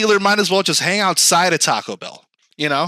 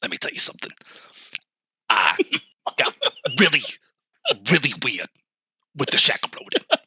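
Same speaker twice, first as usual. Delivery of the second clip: ASMR Stephen A; 3 semitones lower, 3 dB quieter, faster than usual. Acted. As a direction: whisper it into the mic like ASMR. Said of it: "the A is for A S M R".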